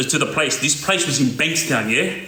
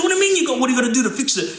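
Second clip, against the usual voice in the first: mock angry retort; 6 semitones higher, much faster than usual. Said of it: "start going off".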